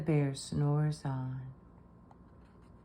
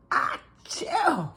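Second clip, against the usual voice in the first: high, loud acted sneeze; cartoon achoo; big sneeze; 7 dB louder than usual. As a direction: let out a huge bear sneeze. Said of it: "He blows and he sneezes".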